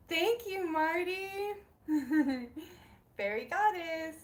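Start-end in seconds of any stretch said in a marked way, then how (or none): none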